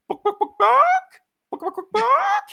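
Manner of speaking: high squeaky clucks, loud and mocking; mock chicken calls, high and loud; chicken clucking. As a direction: cluck like a chicken to lure him out. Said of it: "get a chicken out of hiding".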